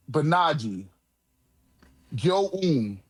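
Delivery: ordinary talk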